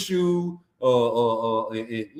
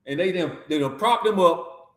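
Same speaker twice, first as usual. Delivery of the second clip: normal talking voice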